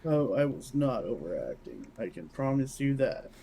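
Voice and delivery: normal talking voice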